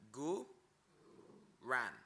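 gu, ran spoken as two slow separate syllables. Shown as a demonstration. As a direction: split gu and ran with a pause. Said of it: "try saying that all together".

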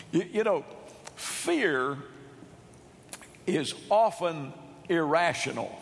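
ordinary talk

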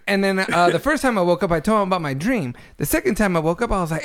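ordinary delivery

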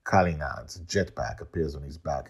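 usual speaking voice

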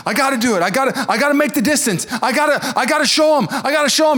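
high, pleading Rocky voice. Retold or imitated. Rocky telling Adrian why he must fight, high and pleading. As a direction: strain upward like Rocky. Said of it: "he goes".

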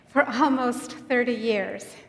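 ordinary talk, nothing marked

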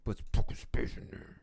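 raspy whispered demon growl. Acted. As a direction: hiss it like a demon. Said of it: "relax your demon voice".

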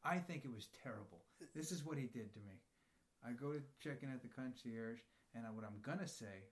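ordinary talk

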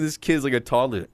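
ordinary talk

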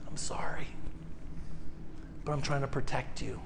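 soft, hushed consoling whisper; tender hushed voice of God. Acted. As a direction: whisper it gently as God comforting a child. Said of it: "God says".

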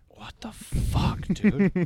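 stunned, drawn-out disbelief; slow, breathy what-the-fuck of shock. Retold or imitated. Waking up wet, shocked.

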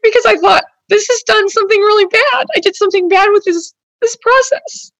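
fighting real tears; tearful, real. Real, not acted. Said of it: "this is so upsetting".

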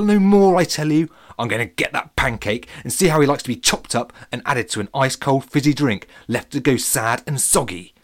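loud, raging little fruit; angry lemon ranting. Acted. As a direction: rant for revenge, loud and high. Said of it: "looking up at the angry lemon".

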